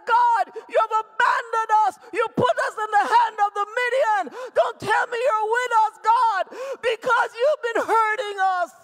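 loud, high shouted accusation; scolding God. Acted. Gideon blaming God for abandoning his people, shouting. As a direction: cry out in angry protest. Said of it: "He's literally scolding God".

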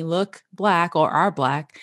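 ordinary talk

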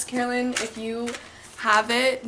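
ordinary delivery

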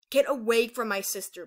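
loud, threatening warning; protective growl. A narrator's reading. Pearl shielding Aurora from Bermuda, growling loudly. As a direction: warn off an attacker forcefully. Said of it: "Pearl growled".

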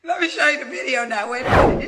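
shrieking excitement over a video; high excited squeal. Real, not acted. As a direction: squeal it in disbelief.

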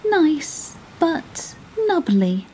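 a small clever fish flattering the whale; small 'stute voice. A narrator's reading. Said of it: "said the small 'stute fish".

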